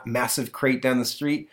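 ordinary delivery

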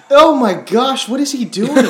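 high, loud, laughing disbelief; startled laughing outcry; shocked disbelief. Real, not acted. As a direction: react as if you can't believe your eyes. Shocked by the video.

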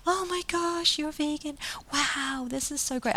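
high, delighted gush; excited squeal. Retold or imitated. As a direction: squeal with joy. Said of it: "I just remember I was like".

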